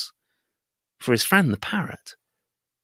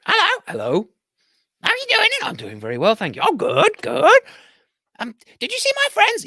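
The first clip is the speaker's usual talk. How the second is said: home parrot; 12 semitones higher, 4 dB louder than usual. Acted. Parrot friend greets merchant.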